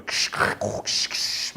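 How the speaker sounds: imitated static hiss; voiceless modem screech imitation; dial-up modem noise. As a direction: hiss and crackle like an old modem. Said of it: "over the internet in the dial-up days".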